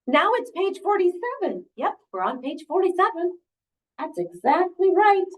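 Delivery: high, animated storybook exclaiming; agitated Elephant. Acted. Elephant watches the pages running out, agitated. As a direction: count the pages in high mock alarm.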